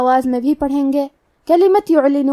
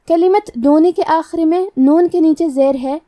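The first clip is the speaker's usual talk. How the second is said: usual speaking voice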